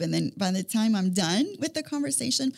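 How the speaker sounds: normal talking voice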